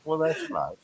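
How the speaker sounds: soft polite brush-off. Retold or imitated. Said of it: "I'm gonna go".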